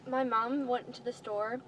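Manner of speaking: ordinary delivery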